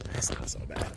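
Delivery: unvoiced murmur, half to himself; whispered to himself. Real, not acted. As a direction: breathe it out as a private reassurance.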